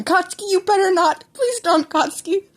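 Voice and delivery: high, loud mother's cry; mother shouting a warning; panicked yell. Acted. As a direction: yell to stop the child in time. Mothers yell to stop child.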